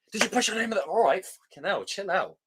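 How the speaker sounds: high, rushed, nothing calm about it; frantic Dumbledore. Retold or imitated. Mocking the film's Dumbledore, high and frantic. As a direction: ham up an agitated headmaster. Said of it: "Dumbledore said calmly".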